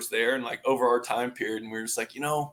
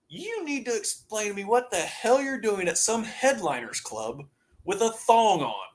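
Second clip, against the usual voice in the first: angry mom; 7 semitones higher, 4 dB quieter than usual. Retold or imitated. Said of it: "She calls me, goes".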